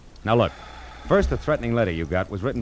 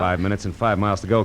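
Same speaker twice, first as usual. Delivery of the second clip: normal talking voice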